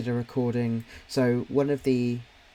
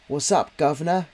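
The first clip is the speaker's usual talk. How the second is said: put-on Cockney accent; 4 semitones higher, 6 dB louder than usual. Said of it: "kind of, like, a Cockney-esque".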